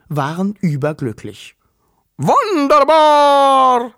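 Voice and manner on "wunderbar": loud, high, long-drawn cheer; jubilant creatures. A narrator's reading. Korkse delighted with their new stove, loud high drawn-out cheer. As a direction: cheer it out slowly with rounded vowels. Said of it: "jubelten die Korkse".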